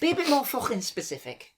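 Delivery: high-pitched, impatient Scouse; Scouse exasperation, pitched right up; Scouse accent. Shown as a demonstration. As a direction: nag in a Liverpool accent. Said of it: "That accent is called Scouse".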